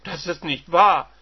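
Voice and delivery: loud furious denial; shocked wife. Acted. Wife denying her husband's news, loud. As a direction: refuse to believe it.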